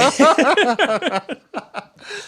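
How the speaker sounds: loud, high drawn-out laughter; long laugh. Real, not acted. Long laugh after exit.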